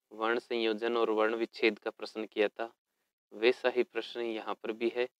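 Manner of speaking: usual speaking voice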